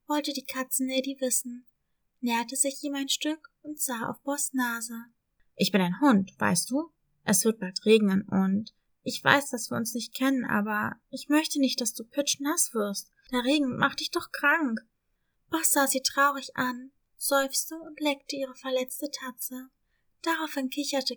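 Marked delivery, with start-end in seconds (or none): 5.6-14.8 caring puppy voice. Acted. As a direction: gently warn her like a caring pup. Dog Boss explains the rain.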